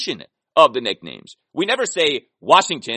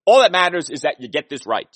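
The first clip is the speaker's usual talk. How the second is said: ordinary talk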